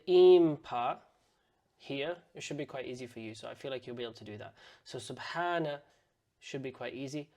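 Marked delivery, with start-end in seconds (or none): none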